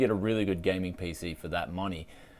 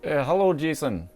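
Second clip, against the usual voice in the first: put-on Scottish accent on the phone; 6 semitones higher than usual. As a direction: greet him in a Scottish brogue. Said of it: "you guys requested I do a Scottish accent".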